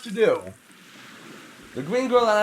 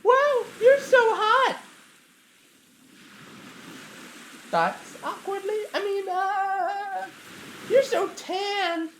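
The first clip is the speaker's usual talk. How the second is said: put-on flustered shy girl; 12 semitones higher, slower than usual. Plant flustered, high voice.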